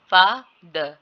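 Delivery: loud "फा", then separate short "द"; syllable by syllable. Shown as a demonstration. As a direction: split "फा द" into two syllables. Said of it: "ये second syllable है". ("फा द" अलग-अलग syllable में)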